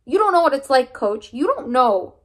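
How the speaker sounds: high, loud, tearful protest; boy on the edge of crying at the coach; near tears. Acted. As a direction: fight the tears and keep shouting. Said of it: "stupid tears are welling up".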